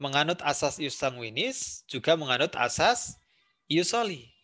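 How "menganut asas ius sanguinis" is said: ordinary talk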